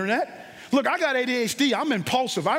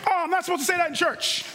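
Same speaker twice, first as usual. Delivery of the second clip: mock churchgoer; 6 semitones higher, faster than usual. Mock reaction from the congregation.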